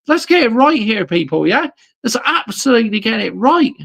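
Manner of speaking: high, loud, near-cracking ranting; angry rant. Real, not acted. Ferrari fan ranting to his stream about the team, high loud strained shout. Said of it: "It needs to be a rant".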